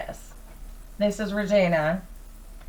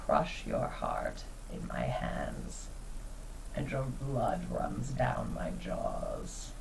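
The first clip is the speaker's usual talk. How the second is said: slow, breathy threat, much softer; menacing whisper; 9 semitones lower, 9 dB quieter, slower than usual. Acted. Regina threatening the huntress over her dragon, slow whisper. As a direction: hiss the threat low and slow.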